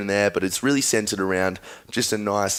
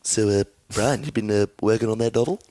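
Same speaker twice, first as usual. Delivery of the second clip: Stewie Griffin. Acted. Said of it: "I can also do Stewie Griffin".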